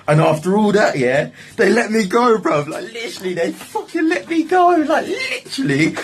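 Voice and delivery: high, delighted, laughing through it; can't believe his luck, voice soaring; excited disbelief. Real, not acted. Storyteller telling friends he got released, high laughing delight.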